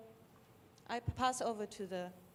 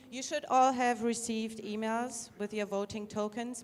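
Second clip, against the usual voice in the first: repeated louder and closer to the mic; louder, firmer announcement; 7 dB louder than usual. Shown as a demonstration. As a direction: project it so the back row hears. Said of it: "louder, closer".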